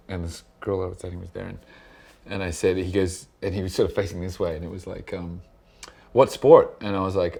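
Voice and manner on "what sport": loud, higher question, imitating club shouting; mock shout over the music; retold yelling. DJ to stranger in loud nightclub, retold yelling. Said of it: "like, yelling at each other".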